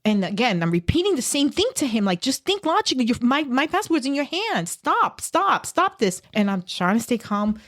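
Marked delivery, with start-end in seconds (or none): 2.2-6.2 exasperated. Retold or imitated. Pleading with the guard.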